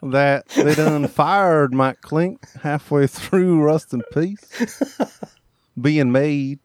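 slow rural Virginia drawl; Winchester, Virginia accent. Acted. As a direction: drawl it like a Virginia country local. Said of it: "Winchester, Virginia English".

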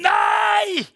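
a loud, high terrified scream of no; shrieking refusal. Retold or imitated. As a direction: scream it in panic. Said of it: "Jeg hadde skriket".

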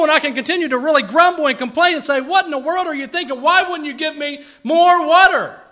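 loud, high, complaining rant; critical grumbling. Pretend. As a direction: whine and gripe loudly at the brother who brought water. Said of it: "Boy, that was a critical spirit".

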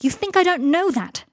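high, loud anguished retort; crying out. A narrator's reading. Harry stung by Draco's insult, crying out. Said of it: "cried Harry".

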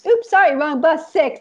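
high, mock-sorry singsong; teasing fake apology. Bus driver sister fooling her waiting sister, mock sorry. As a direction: pretend it's an honest mistake while teasing. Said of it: "I'd be like".

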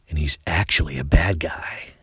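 hushed low whisper of a secret. Real, not acted. Telling the audience the wolf's secret in a whisper. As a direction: let them in on the secret, unvoiced.

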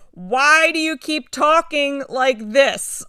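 drawn-out baffled whine; exasperated disciples. Retold or imitated. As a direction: plead like confused students at their wits' end. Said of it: "they're like".

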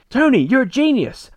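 high, loud burst of delighted admiration; mock gushing praise. Retelling Laura praising Tony after her brain blast, mock gushing. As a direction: exclaim it like a hammed-up revelation.

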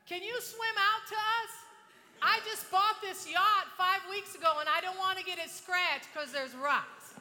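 slow, high, loud calling voice; long-distance holler. Retold or imitated. Yacht owner calling to a woman on shore, long-distance holler. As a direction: shout it out slowly so it carries over water.

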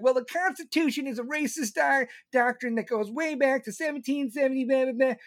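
mock complaining voice; mocking critics. Mocking people who call it racist.

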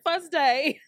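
loud, high laughing. Real, not acted. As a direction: laugh at your own slip. Laughing at her mistake.

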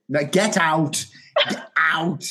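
hammed-up order to leave; mock dismissal. Joking as the author ejecting a fan, mock outrage. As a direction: throw them out in playful outrage.

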